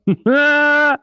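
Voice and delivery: a sudden high squeal of laughter; high burst of laughter. Real, not acted. Streamer laughing at his own joke, a high squeal of laughter.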